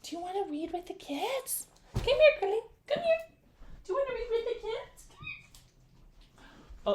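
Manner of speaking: high, soft coaxing voice for a dog; pet talk. Real, not acted. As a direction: coax the dog to come over. Calling the dog over.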